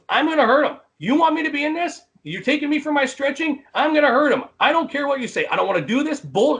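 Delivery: high-pitched mock rant; retold screaming fit, high and insistent; imitating angry screaming. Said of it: "And he's screaming about it".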